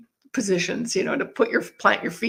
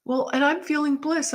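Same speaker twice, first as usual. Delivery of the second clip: ordinary delivery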